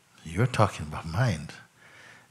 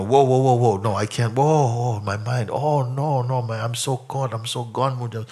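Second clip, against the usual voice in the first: higher, louder panicky mimicry of someone overwhelmed; mock panic; 4 semitones higher, 4 dB louder than usual. Teacher mocking a student swamped by thoughts, panicky voice. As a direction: act out a person flustered by their own mind. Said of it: "I've heard people speak like this".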